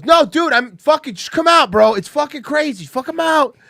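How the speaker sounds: manic high shouting bro; imitating Alex. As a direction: yell it like a hyped friend who won't take no. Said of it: "he calls me and he goes".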